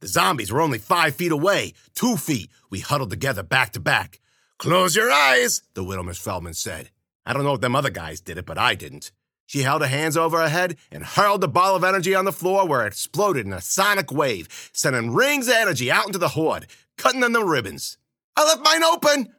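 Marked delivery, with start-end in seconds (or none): 4.6-5.6 high, slow, forceful command; commanding warning. A narrator's reading. Widow warns before blasting the zombies. 18.3-19.3 loud, high exclamation; high-pitched outcry. A narrator's reading. Narrator protests back to the widow.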